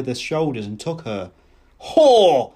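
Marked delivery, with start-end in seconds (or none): 1.8-2.5 loud, raised, hurled insult; explosive angry yell; furious shout. A narrator's reading. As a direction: shout it in rage. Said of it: "he shouted".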